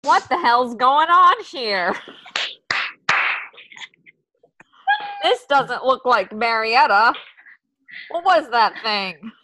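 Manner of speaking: a confused silent film star; Agnes voice. Acted. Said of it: "try to do a voice for Agnes".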